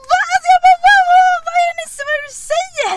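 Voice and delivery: high, loud, fast yelled question; baffled shouting. Retold or imitated. Host re-enacts shouting back at the stranger, baffled yell. Acted yelling back at the girl.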